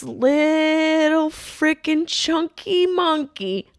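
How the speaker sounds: high, slow cooing voice; mock baby voice. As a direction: coo it like baby talk. Baby-talk mock of pug.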